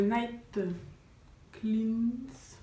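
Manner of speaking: English product name in a French accent. Real, not acted. Vlogger names her night tea to viewers, French-accented English. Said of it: "Excusez mon accent anglais".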